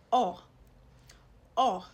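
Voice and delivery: "O" as a loud open aw; grave accent O. Shown as a demonstration. Teacher showing the grave accent O, open sound. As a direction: sound O out firmly as aw. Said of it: "it's pronounced as".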